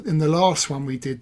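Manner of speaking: usual speaking voice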